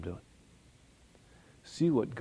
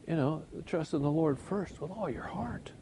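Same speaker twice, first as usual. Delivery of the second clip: soft whisper of devotion; hushed whisper of trust; 4 semitones higher, 6 dB quieter than usual. Real, not acted. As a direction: breathe it like a prayer.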